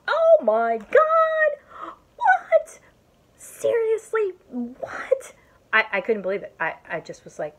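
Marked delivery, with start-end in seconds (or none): 0.1-5.3 very high, loud, stretched disbelief; astonished exclaiming. Retold or imitated. In the break room watching her friend's haul, shrieking amazement. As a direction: shriek in mock shock at the phone. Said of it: "I was sitting in the break room going".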